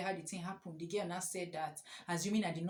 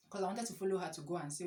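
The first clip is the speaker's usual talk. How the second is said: ordinary talk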